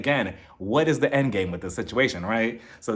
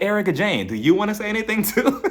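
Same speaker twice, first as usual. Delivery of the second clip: loud, teasing invitation; mock invitation, up high and louder; teasing higher call-out; 6 semitones higher, 4 dB louder than usual. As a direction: egg her on, playfully. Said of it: "I'm like".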